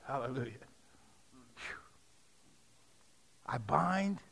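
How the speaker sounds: ordinary delivery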